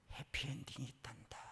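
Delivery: soft whisper; whispered promise. Retold or imitated. God whispering comfort to the daughter. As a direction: breathe it gently. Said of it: "하나님의 음성".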